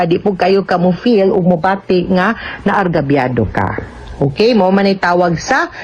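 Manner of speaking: ordinary delivery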